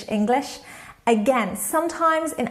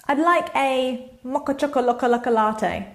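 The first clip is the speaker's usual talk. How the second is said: playful coffee order. Shown as a demonstration. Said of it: "I always joke with my students about the names".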